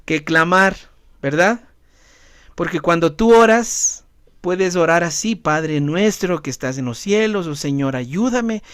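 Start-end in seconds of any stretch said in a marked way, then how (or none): 5.4-8.6 softer, calm praying tone; quiet routine prayer. Shown as a demonstration. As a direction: pray gently, by rote. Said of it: "así en este tono de voz". (oración suave y tranquila)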